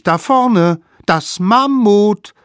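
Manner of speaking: high, loud slow shout; calling out the discovery; excited shout. A narrator's reading. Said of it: "riefen sie plötzlich".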